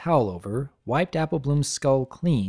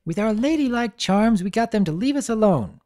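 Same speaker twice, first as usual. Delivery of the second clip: high filly voice; 8 semitones higher than usual. Acted. Sweetie Belle continues.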